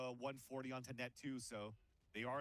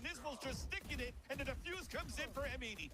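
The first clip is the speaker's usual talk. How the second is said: high, lively play-by-play call; esports caster; 11 semitones higher, 10 dB louder than usual. Acted. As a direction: call the action fast and bright. Lively play-by-play over the action.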